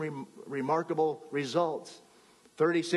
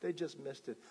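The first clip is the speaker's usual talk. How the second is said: much softer, let-down murmur; disappointed; 3 semitones lower, 11 dB quieter than usual. Acted. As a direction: sink with the loss. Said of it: "they're disappointed".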